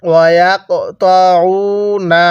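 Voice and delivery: slow drawn-out tajweed recitation; Quranic recitation. Shown as a demonstration. Teacher leading students to repeat, slow recitation. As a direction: stretch each syllable for the class to repeat. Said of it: "বলুন".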